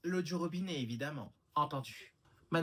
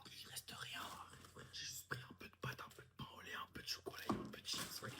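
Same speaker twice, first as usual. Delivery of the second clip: heated voiceless whispering; whispered squabble; 3 semitones lower, 8 dB quieter than usual. Acted. Siblings bicker over a taste of food at night, whispering. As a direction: argue entirely in a whisper.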